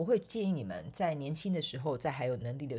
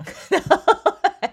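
loud high burst of laughter; 14 dB louder than usual. Real, not acted. Laughing at her mother's excuse. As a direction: break into real laughter.

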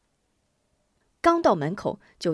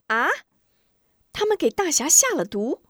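high, raised startled question; shocked mother; 8 semitones higher, 4 dB louder than usual. Acted. Mother hears of the poisoning, high shocked question. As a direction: gasp it out as sudden shock. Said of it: "吴夫人猛吃一惊".

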